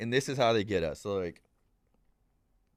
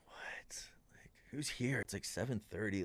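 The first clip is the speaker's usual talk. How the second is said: whispered, startled questions; hushed panic; 12 dB quieter than usual. Retold or imitated. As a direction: whisper it like you're hiding from the door. Hiding from a knock, retold.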